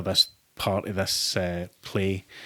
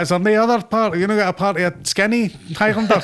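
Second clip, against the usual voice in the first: much higher, louder mock plea; pleading high voice; 10 semitones higher, 6 dB louder than usual. Actor mimics begging casting director for another part. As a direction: squeak it out like a desperate actor. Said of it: "I was like".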